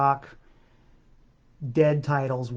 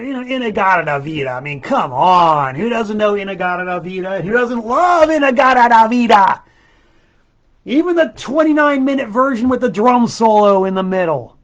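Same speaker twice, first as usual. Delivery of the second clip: loud, gushing enthusiasm for the song; loud, excited fan gushing; 4 semitones higher, 10 dB louder than usual. Real, not acted. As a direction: rave about it like a lifelong fan. Raving about beloved song.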